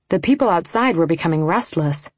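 usual speaking voice

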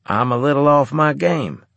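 ordinary delivery, nothing marked

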